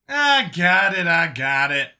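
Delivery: loud, high start that eases down in pitch; Brian's impatient concession. Acted. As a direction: concede loudly in a character voice. Brian concedes, narrator tags him.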